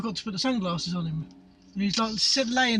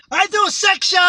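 sudden high, loud shout from the Chinese doctor; mock doctor shout; 10 semitones higher, 10 dB louder than usual. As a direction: bark it out as an urgent order. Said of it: "like, a Chinese doctor".

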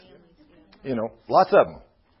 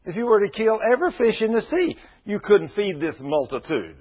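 repeated higher, with more force; emphatic repeat; 7 semitones higher than usual. Retold or imitated. Moses pleading with God about feeding the crowd, emphatic. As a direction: push the "sea" up harder the second time. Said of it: "Moses said".

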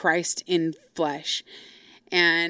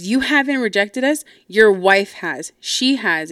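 raised, emphatic comeback, re-enacted; retold indignant accusation; 2 semitones higher, 6 dB louder than usual. Sister confronts dad over who rejected them, indignant.

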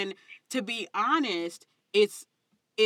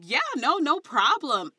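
professional voice; 6 semitones higher, 4 dB louder, slower than usual. Retold or imitated. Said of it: "at first it was, I'd be like".

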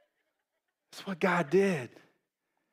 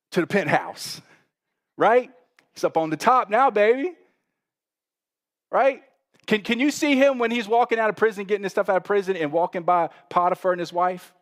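loud, higher, driving sermon voice; full-force preaching; 6 semitones higher, 7 dB louder than usual. Acted. Pastor preaching to the congregation, loud and high. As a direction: preach it out to the whole church.

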